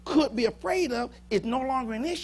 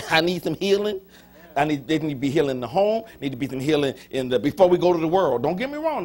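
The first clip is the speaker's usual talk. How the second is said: rising, louder preaching cadence; 4 dB louder than usual. Acted. Pastor to congregation, building preaching. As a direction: build the call for healing in the church.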